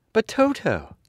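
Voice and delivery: put-on high girl's voice, a touch worried; a child fretting over her dog; little girl asking anxiously; anxious Dorothy. A narrator's reading. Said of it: "said the girl, anxiously".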